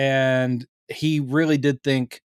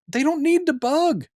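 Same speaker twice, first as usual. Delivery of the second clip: exasperated, much higher-pitched retort; Carol; 10 semitones higher than usual. Retold or imitated. Woman telling paranoid guest they already know, high exasperated voice. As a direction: pitch it way up, insisting on the obvious. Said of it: "and she's like".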